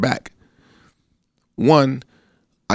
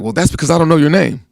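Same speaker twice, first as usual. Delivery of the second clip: irritated officer; 6 semitones higher, 3 dB louder than usual. Retold or imitated. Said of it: "He was like".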